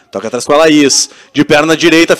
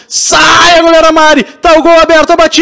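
explosive goal commentary; 8 semitones higher than usual. Real, not acted. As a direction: shout as the goal opens up. Keeper out, open goal shot.

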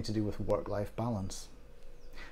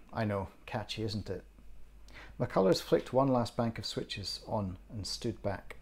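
ordinary delivery

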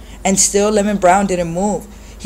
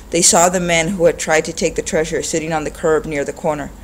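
ordinary delivery, nothing marked

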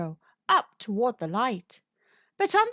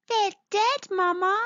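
very high little-creature voice; little mole; 10 semitones higher, 3 dB louder than usual. Acted. Little mole looks at a shriveled bulb, high-voiced.

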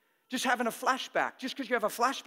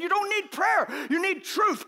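louder and higher, prayer pushed up hard; emphatic shout; 9 semitones higher, 5 dB louder than usual. Shown as a demonstration. Preacher telling the congregation they need no prayer, loudly. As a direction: hit it like a rebuke. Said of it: "Don't ask me".

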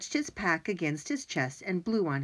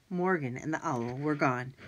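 ordinary delivery, nothing marked

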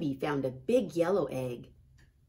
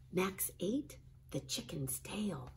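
ordinary delivery, nothing marked

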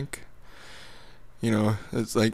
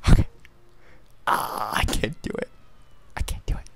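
whispering; 2 semitones lower, 5 dB quieter than usual. Pretend. Whispered reluctant goodbye.

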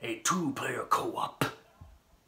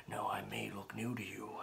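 menacing whisper; 5 semitones lower, 14 dB quieter, faster than usual. Acted. Menacing aside in promo.

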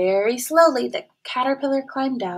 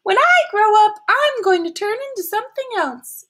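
much higher, bragging caterpillar voice; proud caterpillar; 11 semitones higher, 6 dB louder than usual. A narrator's reading. Caterpillar boasting to the snail, high proud voice. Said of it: "she told the turtle".

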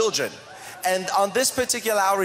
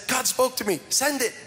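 higher-pitched, firm retold order; retold insistence; 7 semitones higher than usual. Preacher retelling orders to his finance person, insistent.

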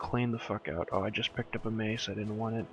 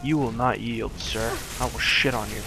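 angry taunt; 8 dB louder than usual. Real, not acted. Taunting enemy mid-fight.

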